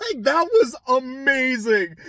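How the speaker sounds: high laughter through the words; laughing. Real, not acted. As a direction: crack up while you say it. Laughing at own retelling.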